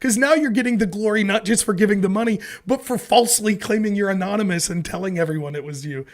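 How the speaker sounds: high, loud indignant rant; outraged Larry. Retold or imitated. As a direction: go off at him like an offended Larry. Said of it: "Larry's argument's like".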